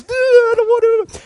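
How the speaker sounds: high-pitched mock whimper of refusal; scared reluctance. Everybody balking at the dark tunnel, high mock-scared whine.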